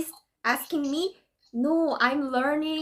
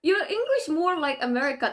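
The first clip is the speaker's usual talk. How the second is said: normal talking voice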